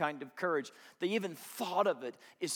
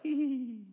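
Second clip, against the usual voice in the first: higher, coy mock giggle; flirty giggle; 7 semitones higher than usual.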